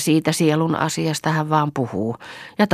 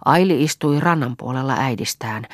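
ordinary delivery, nothing marked

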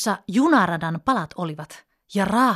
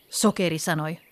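ordinary delivery

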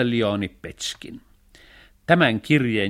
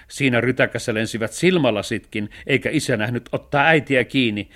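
plain reading voice

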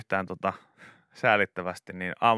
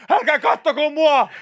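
high, loud mock yell; pretend shout; 7 dB louder than usual. Lone diner yelling at staring customers, hammed-up high shout. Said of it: "tekis mieli aina huutaa".

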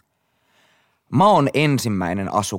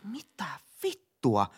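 whispered, shocked swear; 7 semitones higher, 7 dB quieter, much slower than usual. Retold or imitated. Finding the toilet seat soaked, a shocked whisper. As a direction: hiss the curse under your breath.

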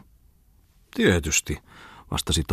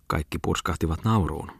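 ordinary delivery, nothing marked

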